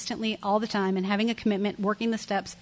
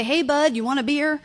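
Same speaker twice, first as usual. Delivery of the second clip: higher, louder, friendly call across the parking lot; Red's offer; 6 semitones higher, 4 dB louder than usual. Retold or imitated. Red calling out to her from his cooler, higher and louder. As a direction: hail him cheerfully like the guy on the cooler. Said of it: "And he would always say".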